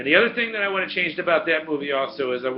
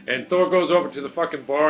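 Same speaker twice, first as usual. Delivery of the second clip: normal talking voice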